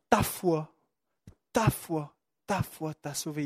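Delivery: soft, low and tender "ta foi t'a sauvé", almost hushed; gentle quiet declaration. Acted. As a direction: let "ta foi" fall quietly, like a kind word to one man. Jesus' words retold tenderly.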